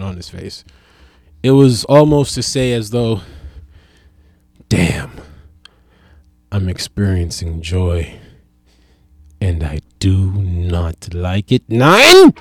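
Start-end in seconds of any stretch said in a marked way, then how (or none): 4.7-11.6 put-on lightly done German accent, lower and slow; a hint of a stiff German, slow and low; mock German accent. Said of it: "That's the best I got for a German accent". 11.7-12.3 German "Nine?" shot up high and loud; mock German accent. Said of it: "That's the best I got for a German accent".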